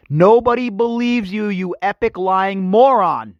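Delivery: drawn-out, high-pitched insult; scornful proclamation. Real, not acted. Host recaps for the lying prince, scornful proclamation. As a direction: proclaim it slowly, pitched well up.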